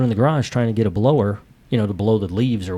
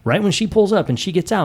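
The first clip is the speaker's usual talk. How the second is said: ordinary talk, nothing marked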